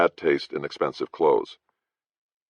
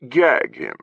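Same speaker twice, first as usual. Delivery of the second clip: gag him tossed off lightly; casual order; 5 semitones higher, 5 dB louder than usual. Acted. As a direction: dismiss it, an offhand command. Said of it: "said with a casual wave".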